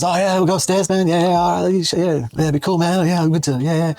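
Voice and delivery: high, dopey stoner voice; stoned guys. Retold or imitated. Guy on ice telling the others they'll go upstairs, dopey stoner voice. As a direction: play the mellow, spaced-out junkie. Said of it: "they're kind of being a bit like".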